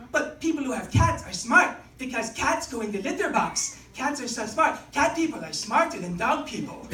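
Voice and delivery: put-on calmer, matter-of-fact French Canadian accent. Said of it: "like, French Canadian accent".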